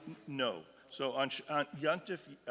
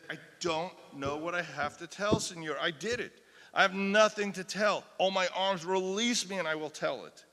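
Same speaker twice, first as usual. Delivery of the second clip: desperate plea; 4 semitones higher, 6 dB louder than usual. Acted. Acted plea of tortured woman.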